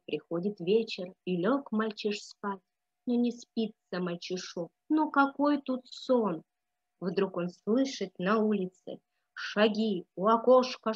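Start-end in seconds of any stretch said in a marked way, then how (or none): none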